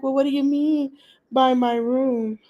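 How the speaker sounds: a hint of her questioning voice; imitating her puzzled question. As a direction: lightly mimic a woman asking what is meant. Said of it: "You know how she do".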